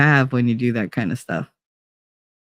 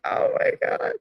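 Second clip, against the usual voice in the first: breathless high whispered gasp; whispered mock shock; 7 dB quieter than usual. Hearing about his G-string party job, whispered mock shock.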